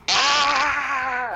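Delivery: grito agudo sostenido de A; grito de la letra A. Shown as a demonstration. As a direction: grita la A con toda la fuerza. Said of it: "solo pude gritar la letra A". (grito agudo de A pidiendo auxilio)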